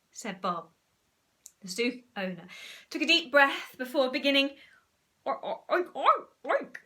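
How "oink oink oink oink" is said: hesitant oinks at moderate volume; soft oinking. Acted. Zoo owner's first cautious oinks at the frog. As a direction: oink a bit timidly.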